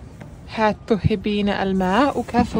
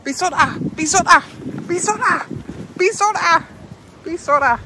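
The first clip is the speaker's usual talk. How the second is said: excited urging; 9 semitones higher, faster than usual. Real, not acted. Urging toddler along.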